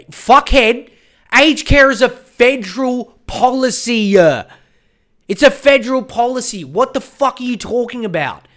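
loud, high angry rant; exasperated rebuke. Acted. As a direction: hammer it home at an evasive politician. Angry retort to Morrison's excuse.

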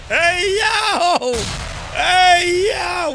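high, drawn-out shout; excited hype yell; excited call. Real, not acted. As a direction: holler it out, loud and high. Calls out, excited after the bit.

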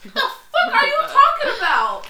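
loud, high laughing disbelief; incredulous laughing shout; laughing outburst. Real, not acted. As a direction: blurt it out through laughter. Reacting laughing to quote.

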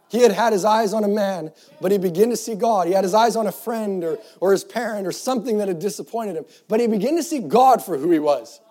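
preacher lifting the congregation; full-force preaching. Acted. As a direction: proclaim it to the whole church. Preaching build.

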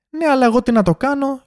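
high-pitched mock customer voice; mimicking the customer. Customer arguing with cashier, high mock indignant voice.

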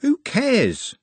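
very high, indignant brush-off; exasperated Trev waving it away; dismissive. Acted. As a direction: toss it out high and scornful. Trev dismisses the orc worry.

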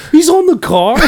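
high, loud, laughing mock alarm; excited shout. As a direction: cry it out half laughing.

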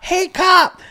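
high, loud mocking yell; shouted taunt. Kid yelling to taunt a cop, loud and high.

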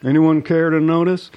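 high, loud put-on voice; mock Charlemagne. Vlogger mocking Charlemagne to viewers, high loud put-on voice. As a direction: ham up an attention-seeking call. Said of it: "Charlemagne's like".

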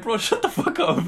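high laughing through the words; can't stop laughing as you speak. Real, not acted. Retelling the funny story, laughing high.

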